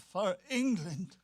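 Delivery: slow, sullen angry sailor voice; angry growl. Acted. Sailor after a coin, growling angrily and slowly. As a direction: grind it out with sour resentment. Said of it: "He halted and growled angrily".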